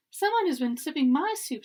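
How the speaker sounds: a lightly voiced cross mother bear; growling Mama Bear. A narrator's reading. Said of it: "she growled".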